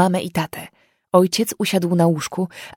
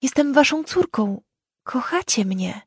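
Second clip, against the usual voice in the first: tearful plea; 6 semitones higher than usual. Acted. Crying girl pleads with parents.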